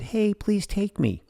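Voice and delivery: high, slow little toy voice; toy begging. Pretend. Stuffed toy voiced in story.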